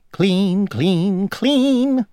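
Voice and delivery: high, singsong sweeping chant; fussy housefly. A narrator's reading. Housefly sweeping the road, high singsong chant. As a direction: chant it brightly upward, word by word.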